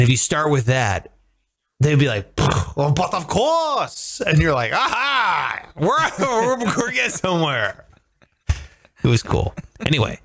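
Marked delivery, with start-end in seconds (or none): 2.4-4.2 mock French politeness. Voicing polite French reply. 4.7-7.8 mock triumphant tourist. Pleased tourist voice.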